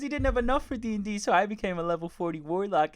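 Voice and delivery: high-pitched mock mum voice; gushing mum imitation. Mum to son with friends over, high mock gushing. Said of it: "his mum's like".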